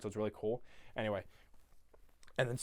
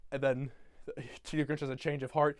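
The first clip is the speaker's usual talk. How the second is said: ordinary delivery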